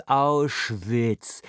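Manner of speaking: "Auschwitz" stretched out syllable by syllable, "Owwsh-viits"; drawn-out correct pronunciation. A narrator's reading. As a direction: drill "Auschwitz" slowly with German "au" and "sch". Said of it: "Lern erst mal das richtig auszusprechen".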